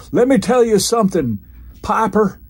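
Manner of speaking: high, loud tough-guy wrestler promo; Orndorff impression. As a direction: bark it like a stiff wrestler cutting a promo. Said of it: "Paul Orndorff keeps saying".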